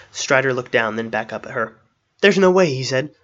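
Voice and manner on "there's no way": high, loud protest; urgent refusal. A narrator's reading. Strider hanging by his fingertips answers Arwen, urgently. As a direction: call back up that it's hopeless. Hanging man calls back up.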